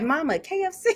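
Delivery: put-on playful baby voice; lightly voiced toddler begging. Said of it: "That baby was probably like".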